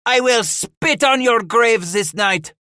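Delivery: high, loud, slow vow of vengeance; yelled threat. A narrator's reading. Paul threatens the matriarch in the stockade, yelling angrily. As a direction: hurl the threat, stretching the words. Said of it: "Paul yelled".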